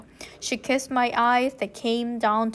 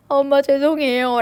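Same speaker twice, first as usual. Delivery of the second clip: tearful apology; 2 semitones higher, 7 dB louder than usual. A narrator's reading. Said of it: "코를 훌쩍훌쩍 거리면서".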